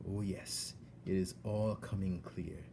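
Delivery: soft, slow, breathy threat whispered; menacing whisper. Acted. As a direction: savour your revenge under breath. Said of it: "he whispered".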